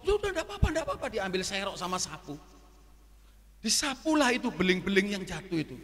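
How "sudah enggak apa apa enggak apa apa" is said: suara tinggi menirukan manajer; buru-buru menepis rasa bersalah tamu; manajer menenangkan. Retold or imitated. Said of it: "Dia ngomong seperti itu". (tiruan manajer yang menenangkan dengan nada tinggi)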